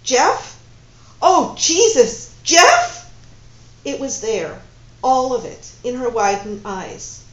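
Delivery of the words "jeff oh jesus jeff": loud, high disbelieving cries, slow on oh Jesus; shocked. Acted. Woman suddenly recognising her lover, loud shocked disbelief. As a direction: gasp out his name in stunned recognition. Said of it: "looked at him in shock".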